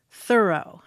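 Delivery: "Thorough" set apart, stressed on the first syllable; directed pronunciation. Shown as a demonstration. Host quotes what the film's actors were told, first-syllable stress on "Thorough". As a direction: say "Thorough" as THUR-oh after a short pause. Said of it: "all directed to pronounce his name".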